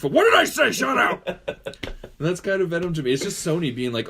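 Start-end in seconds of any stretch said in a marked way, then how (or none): 0.1-1.3 loud, high gruff Thing; hammed-up Thing yelling; Ben Grimm voice. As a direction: snap it as the Thing. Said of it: "it is like Ben Grimm".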